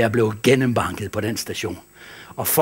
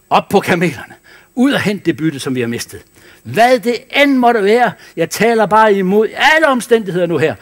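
high, loud rallying preaching; 7 semitones higher, 7 dB louder than usual. Acted. Pastor sending the church out to reclaim what was lost, loud preaching. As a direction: call them to rise and go.

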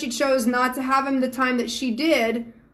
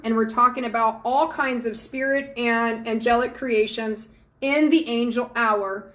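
ordinary talk

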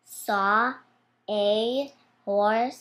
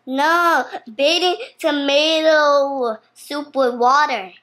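loud, raised-pitch protest; loud correction; 4 semitones higher, 9 dB louder, faster than usual. Real, not acted. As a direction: pipe up loudly to set someone straight. Child loudly corrects mother.

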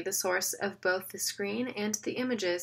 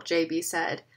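plain reading voice

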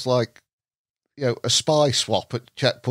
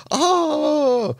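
shocked oh; 3 dB louder than usual. Retold or imitated. French caller realises car in London.

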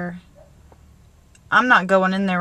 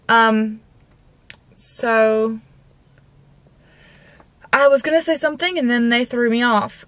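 normal talking voice

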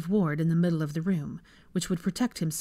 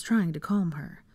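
plain reading voice

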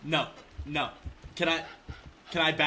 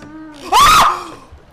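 sharp shrieking gasp; real terrified gasp; loud scared gasp; 22 dB louder than usual. Real, not acted.

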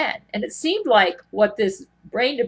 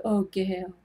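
a hint of a softer, heartbroken imitation; fake sorrowful Jesus; 3 semitones lower, 5 dB quieter than usual. Zach Knight posing as Jesus to Gail, lightly mimicked sorrow. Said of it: "This is how he came across to me".

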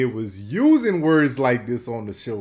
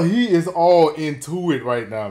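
normal talking voice

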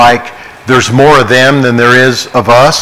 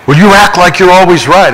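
higher, louder accusing retort; a retold critic, higher and louder in anger; indignant accusation; 6 semitones higher, 5 dB louder than usual. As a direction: snap it like you're fed up. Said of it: "people so angry at me".

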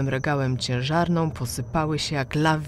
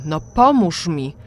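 raised irritated voice; 5 semitones higher, 7 dB louder than usual. A narrator's reading. Irritated trapped mother demands help from daughter, loudly. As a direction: snap at her to help, voice raised. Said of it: "zwróciła się do mnie podniesionym głosem".

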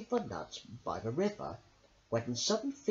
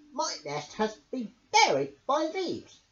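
higher, gushing hedgehog voice; babbling hedgehog; 10 semitones higher, 4 dB louder than usual. A narrator's reading. Hedgehog Boo tells Bramble about the nest, babbling. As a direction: babble out the news. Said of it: "babbled Boo".

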